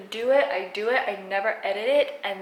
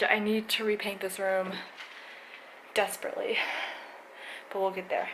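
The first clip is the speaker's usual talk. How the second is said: usual speaking voice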